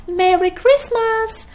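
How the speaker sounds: a high, loud, bursting Merry Christmas; joyful cry. A narrator's reading. Mister Stingy greets Mister Happy at his door, joyful high cry. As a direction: shout it out like he can't hold in the joy. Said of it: "cried Mister Stingy".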